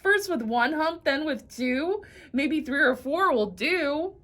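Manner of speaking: high, scornful outburst; mock the camel's humps from on high; arrogant exclaiming. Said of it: "the arrogant donkey exclaimed".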